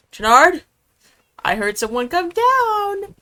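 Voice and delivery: high, loud call to someone below. Real, not acted. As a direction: call up the stairs, high and slow. Calling out to someone downstairs.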